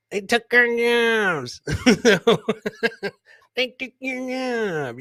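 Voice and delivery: South Park voice. Retold or imitated. Host doing a cartoon voice for his viewers, high and slow. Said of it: "South Park voice".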